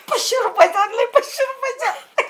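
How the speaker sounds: high-pitched giggly bragging; gleeful boast. Acted. Boasting about the dress to sweetheart. (आनंदाने बढाई मारत, उंच आवाजात)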